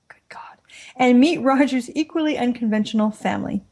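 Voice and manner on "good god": whispering under the breath; a quick hushed aside; whispered aside. Real, not acted. Aside inside episode synopsis.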